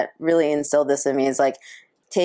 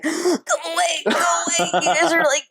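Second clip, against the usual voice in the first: teary protest; 9 semitones higher than usual. Retold or imitated. To friends hugging her before the entrance, a high teary protest. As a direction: push them off while half-crying.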